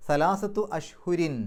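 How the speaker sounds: slow, chanted Quranic recitation. Shown as a demonstration. Teacher recites for students, slow chanted recitation. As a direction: intone the words slowly. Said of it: "പാരായണ നിയമങ്ങൾ നോക്കാം".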